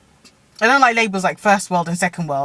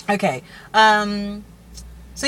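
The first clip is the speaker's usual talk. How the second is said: ordinary talk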